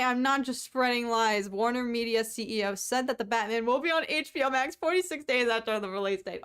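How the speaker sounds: normal talking voice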